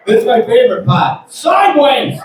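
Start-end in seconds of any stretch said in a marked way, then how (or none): 1.3-2.2 high, punched-out punchline yell; mock threatening shout. Driver capping his threat to the comedian, mock shout. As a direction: yell it as the final insult.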